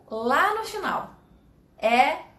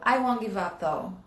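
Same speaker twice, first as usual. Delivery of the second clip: "though" said much lower at the end; falling though; 3 semitones lower than usual. Shown as a demonstration. Teacher showing the fall on "though" once more. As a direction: let "though" fall right down. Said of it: "Ele cai".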